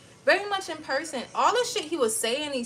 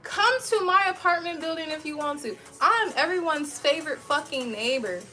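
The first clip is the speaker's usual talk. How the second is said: high, loud mock invitation; taunting comeback; 4 semitones higher, 5 dB louder than usual. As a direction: dare them to come over, laughing it up. Taunting reply to threat retold.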